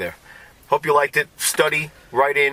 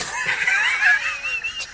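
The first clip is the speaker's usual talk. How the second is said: shrieking laugh. Real, not acted. Laughs at joke.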